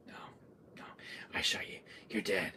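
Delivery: soft, acted denial; quiet before the outburst; hushed disbelief. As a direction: murmur it to yourself in disbelief.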